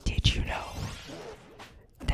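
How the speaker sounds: soft whispered trivia; ASMR whisper. Pretend. ASMR trivia to the stream, whisper. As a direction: whisper it like a secret. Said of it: "A S M R".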